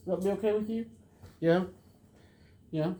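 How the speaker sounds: high sing-song question to the dog, asked again; high pet talk. Real, not acted. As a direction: coax the dog a second time.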